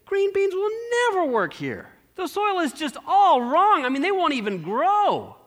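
put-on a young man sure the plan is wrong; son doubting. Son judging the soil.

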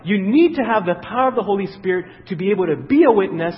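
loud, fervent preaching; preaching build. Acted. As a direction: drive it home to the congregation. Preaching at full force.

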